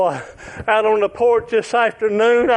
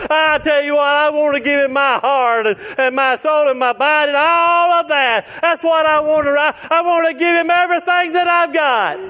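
higher, lifted testimony; preaching; 5 semitones higher than usual. Acted. Preacher declaring he'll give the Lord everything, fervent. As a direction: swell it up with preacher's fervour.

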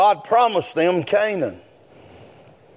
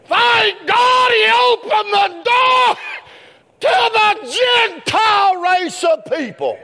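building preaching; 10 semitones higher than usual. Acted. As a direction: climb to a full-throated preacher's cry. Sermon rises to shouted praise.

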